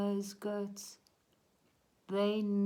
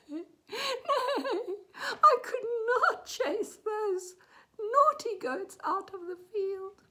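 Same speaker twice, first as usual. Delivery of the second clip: high, whinnying acted sobs; crying horse; 7 dB louder than usual. A narrator's reading. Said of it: "he started crying".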